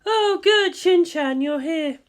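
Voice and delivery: a voiced guardian, deadpan; flat Misato voice. A narrator's reading. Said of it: "Misato said flatly".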